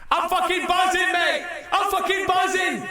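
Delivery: full-throated stoked shout, fist in the air; ecstatic whoop. Retold or imitated. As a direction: yell it out like you just caught the wave of your life. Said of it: "and I'm like".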